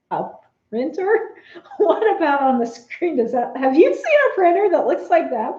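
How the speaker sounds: a printer, really?; incredulous. Retold or imitated. Telling the TSA agent, laughing in disbelief. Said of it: "I'm looking at that going".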